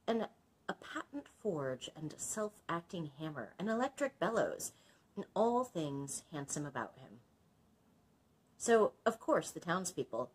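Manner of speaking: storyteller voice; story narration. A narrator's reading. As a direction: read it like a fairy tale to a class. Reads story aloud.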